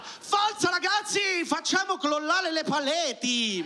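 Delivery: stoned cat yelling. Retold or imitated. The stoned Siamese cat shouting to the band, high and slow. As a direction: yell it like a wasted cat hyping the band.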